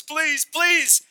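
very high, loud pleading; hammed-up desperate plea; begging. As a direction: beg in a high demon squeal. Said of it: "Demons begged Jesus".

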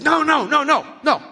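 high, loud, rapid denials; insistent no. Retold or imitated. Frustrated stranger corrects the preacher, high and loud. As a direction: cut him off, urgently.

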